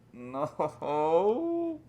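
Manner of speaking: long, hammed-up "no" wail; playful cry of despair; mock anguish. Mock begging fans to stop.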